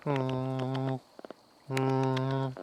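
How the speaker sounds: high squeaky weasel noises; sly hissing weasel; weasel impression. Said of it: "That's my weasel impression".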